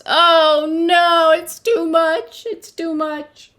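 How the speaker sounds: overwhelmed Peter. Acted. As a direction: wail that it's too much.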